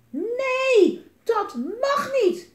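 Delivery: put-on very high, loud, drawn-out child's scream. A narrator's reading. Twins refuse to let the army come, screaming. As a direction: scream it out in protest. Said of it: "gilden Jelmer en Elise".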